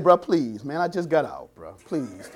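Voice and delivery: low, soft pleading please. Retold or imitated. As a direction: beg quietly, almost laughing. Retold inmate pleading softly.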